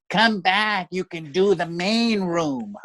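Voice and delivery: high, slow, whiny impression; Mitzi Shore. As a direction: wail it out, long drawn vowels. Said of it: "like that".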